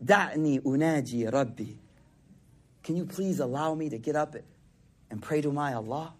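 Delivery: low, hushed plea to a wife; imitated whisper. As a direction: ask very softly, as if whispering at her side. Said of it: "So he whispers to her".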